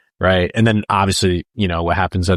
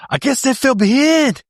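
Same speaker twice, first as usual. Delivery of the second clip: high-pitched Southern maniac impression; hammed-up imitation of an actor's rushed delivery; Norwood; 4 dB louder than usual. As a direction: mimic a frantic movie line, pitched way up. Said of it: "this guy's just delivery and how rushed it is".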